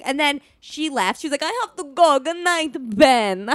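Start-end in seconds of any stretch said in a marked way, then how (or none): 1.4-3.5 put-on Italian-accented flirty goodbye, pitched up; mock Italian accent. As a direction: imitate an Italian flirt taking her leave. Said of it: "it was a good accent you did".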